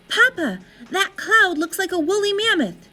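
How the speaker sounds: put-on high, eager little child voice; excited child. A narrator's reading. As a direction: point at the sky, thrilled. Child calls to father.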